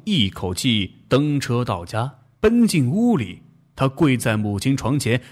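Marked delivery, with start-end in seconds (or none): none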